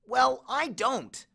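high, drawn-out complaint; complaining. A narrator's reading. Grover protests that he doesn't get it, complaining. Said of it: "Grover complained".

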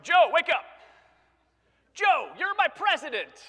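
high, very loud holler down the phone; hammed-up excited shouting; mock wake-up yell. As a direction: shout to rouse a sleeper, overexcited. Yelling at president on phone.

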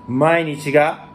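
slow, careful catchphrase; said slowly. Shown as a demonstration. To viewers, slowly saying the catchphrase. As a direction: take it slowly, still getting used to it. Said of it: "ちょっとゆっくり言わないと".

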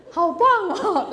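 high, loud, slow admiring coo; gushing praise. Retold or imitated. Wife cooing praise at her husband, high and slow. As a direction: lay the praise on sweetly for him.

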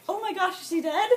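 high-pitched retold alarm with a laugh; hammed-up shocked question; mock shock. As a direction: gasp it out in amused horror. Acted shock after the crash.

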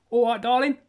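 high, loud Cockney "All right, darling"; Cockney accent. Retold or imitated. Mock Cockney to the viewers, "All right, darling".

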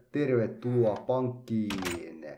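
ordinary delivery

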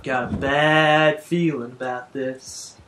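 slow, low movie-line delivery; Star Wars quote. Retold or imitated. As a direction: say it like the famous film line. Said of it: "Star Wars reference".

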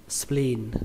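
"spleen" with the "ee" drawn out; long vowel. Shown as a demonstration. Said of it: "Chúng ta kéo nó ra". (kéo dài âm i trong "spleen")

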